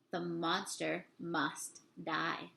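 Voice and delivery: ordinary delivery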